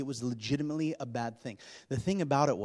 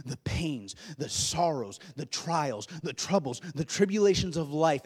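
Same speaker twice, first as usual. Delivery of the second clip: usual speaking voice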